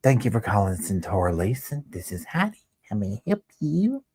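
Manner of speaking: sing-song high phone greeting, lifting up at the end; high sweet Southern receptionist. Retold or imitated. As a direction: imitate a sugary Southern receptionist answering the phone. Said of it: "She'd be like".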